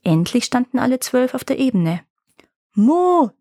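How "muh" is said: high imitated moo; loud cow moo. A narrator's reading. Bella the cow cries out on the plain, mooing high. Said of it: "schrie Bella".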